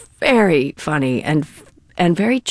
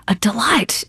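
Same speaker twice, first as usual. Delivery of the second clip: ordinary talk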